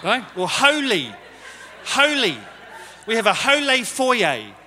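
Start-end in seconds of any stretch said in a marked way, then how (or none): 0.5-2.4 a light mock of Dan saying "holy", a little higher and louder; lightly voiced Dan saying "holy"; imitating Dan's accent. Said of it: "He says things like". 3.1-4.5 "holy foyer" drawn out slowly in a mock Dan accent; string Dan's two words together, slow and a bit louder, teasing; playful slow mock of Dan's "holy foyer"; imitating Dan's accent. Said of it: "He says things like".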